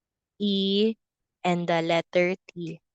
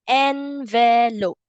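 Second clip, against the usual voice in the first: "Envelope" stretched slowly, syllable by syllable; teacher slowing "Envelope" for young learners; slow modeled word; 3 semitones higher, slower than usual. Shown as a demonstration. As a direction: drag out "Envelope" so the V is heard. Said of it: "Where do we put envelope?".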